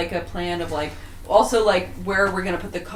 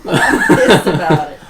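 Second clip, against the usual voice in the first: loud, high laughing rant; laughing mock anger; 3 semitones higher, 7 dB louder than usual. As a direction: rant through laughter as the wife. Playing angry pregnant wife.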